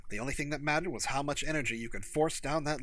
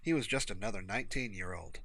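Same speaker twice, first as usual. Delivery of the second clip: ordinary delivery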